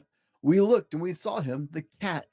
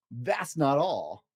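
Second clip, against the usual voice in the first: slow teasing; much slower than usual. Acted. Cat teasing the children, slow and drawn out. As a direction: stretch it out for suspense.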